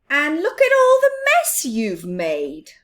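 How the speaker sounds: scolding. Acted. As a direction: tell off the messy mouse. Big Mouse scolds the mess.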